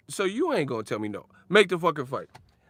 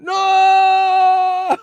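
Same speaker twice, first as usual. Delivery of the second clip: mock scream; 14 dB louder than usual. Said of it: "I think it'll make money".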